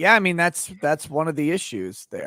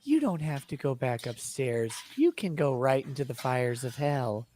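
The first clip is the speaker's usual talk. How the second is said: soft, lower, gently soothing mom; calm Midwest mom voice; 4 semitones lower, 6 dB quieter than usual. Retold or imitated. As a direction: imitate a placid Midwestern mother. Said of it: "calmest Midwest mom voice".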